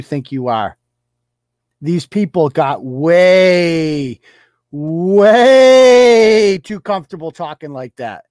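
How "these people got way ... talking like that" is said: way stretched out slow, rising to loud; drawn-out outrage. Real, not acted. Ranting about people talking like that, slow and loud. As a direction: drag out the way, then blast the rest.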